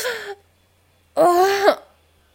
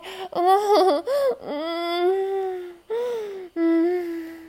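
breathless laugh. Real, not acted. Long breathless laugh-cry.

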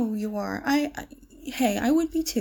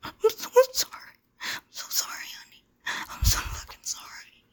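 high gasp falling to whisper; breathless, whispered apology, pleading; distraught apology; 9 semitones higher, 4 dB quieter than usual. Acted. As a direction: apologise desperately, voice dropping into a whisper. Tearful apology to captive partner.

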